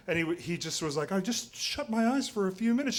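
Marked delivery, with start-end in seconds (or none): 1.1-3.0 a hint of a higher, sheepish husband; lightly voiced excuse from a tired man; husband lightly voiced, excusing himself to his partner; mumbling husband. Husband answers nagging wife.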